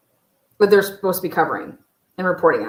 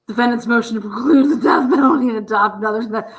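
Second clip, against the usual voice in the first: mock reading; 3 semitones higher, 5 dB louder than usual. Reading document title mockingly.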